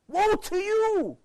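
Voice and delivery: high, loud anguished plea; cry of love. Acted. Parents pleading with their straying son, a loud high cry of love. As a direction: cry out to the son in loving desperation. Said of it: "This is a cry of love".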